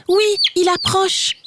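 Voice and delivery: chirping chicks. Acted.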